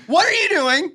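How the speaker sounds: put-on startled girlfriend. Voicing the girlfriend in the scene.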